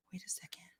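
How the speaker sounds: whispered double take. Real, not acted. Spotting a familiar name in the chat, whispered. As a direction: breathe it out in sudden surprise.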